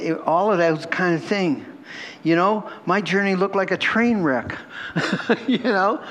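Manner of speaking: ordinary delivery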